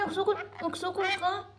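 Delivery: high-pitched playful disbelief; mock indignation. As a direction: act shocked that baby dares fight back. Mock scolding the baby. (tinis nga kunohay natingala ug nasuko)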